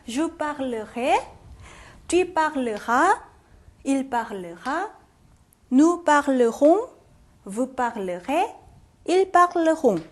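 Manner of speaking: slow chant from Je parlerai to ils parleront, syllable by syllable; conjugation drill. Shown as a demonstration. Teacher runs through 인칭별로 forms, slow pronunciation drill. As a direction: recite each form from Je parlerai on, drawn out with pauses between. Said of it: "인칭별로 다 해볼까요".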